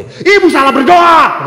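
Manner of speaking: loud, high rebuke. Retold or imitated. Priest raising his voice to scold the woman, loud. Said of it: "Akhirnya saya kencengin suara saya".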